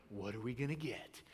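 low, soft, conspiratorial question; imitating a child's secretive murmur; hushed kid imitation. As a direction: ask it under your breath like an eager kid. Kids asking dad in the car.

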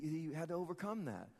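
normal talking voice

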